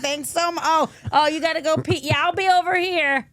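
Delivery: high-pitched, exaggerated sarcastic thanks; mock gushing sarcasm. Host playing herself left to clean up alone, mock gushing. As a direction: put on a high, over-the-top gushing voice. Acting out resentful aftermath sarcastically.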